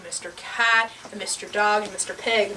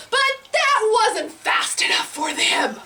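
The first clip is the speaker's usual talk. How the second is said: usual speaking voice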